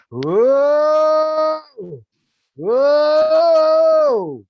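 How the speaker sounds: long wailing "Whoa" pitched very high and loud, like a siren. Shown as a demonstration. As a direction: wail it out like a siren, in harmony. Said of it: "I want it to sound like a siren".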